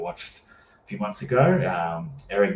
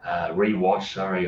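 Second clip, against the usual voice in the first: ordinary talk, nothing marked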